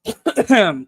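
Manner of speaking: loud hacking cough; real cough; coughing fit. Real, not acted. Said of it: "Sorry about that".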